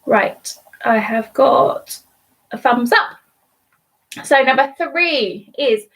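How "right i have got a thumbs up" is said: normal talking voice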